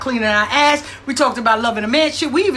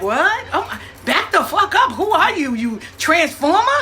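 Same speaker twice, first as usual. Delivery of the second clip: mock shocked disbelief; 5 semitones higher than usual. Mock reaction to older woman.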